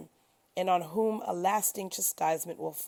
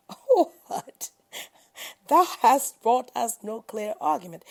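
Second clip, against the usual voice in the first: scornful retort; 4 semitones higher, 7 dB louder than usual. A narrator's reading. People mock the prophet.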